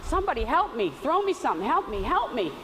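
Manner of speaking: high-pitched mock cry for help; mock hollering. Skinny guy fallen in the river hollers for help, hollering. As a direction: holler for rescue, acting it out. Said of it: "he starts hollering".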